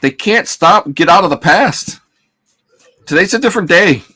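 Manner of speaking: high mocking imitation of analysts. Mocking analysts' stuck talk.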